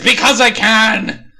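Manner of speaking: slow, high, loud mock-evil boast; cartoon villain yelling his reason; villain shout. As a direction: ham up the gloating. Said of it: "he then says".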